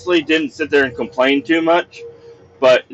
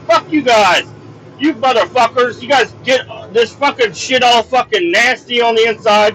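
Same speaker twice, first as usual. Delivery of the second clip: angry yelling; 9 semitones higher than usual. Retold or imitated. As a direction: let them have it, yelling high. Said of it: "I didn't really hold back".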